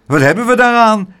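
loud, high scornful question; indignant shout. A narrator's reading. Bitten neighbour rejecting the boy's apology, loud indignant shout. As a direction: shout it back in protest. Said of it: "schreeuwde een meneer".

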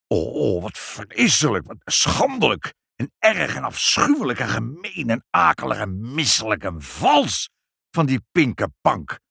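outraged shout. A narrator's reading. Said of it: "schreeuwde vader".

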